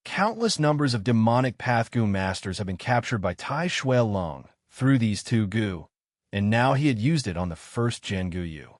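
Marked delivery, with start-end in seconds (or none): none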